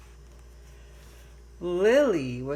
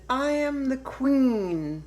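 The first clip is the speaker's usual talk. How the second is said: proud little queen; 6 semitones higher, 4 dB louder than usual. Acted. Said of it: "she said".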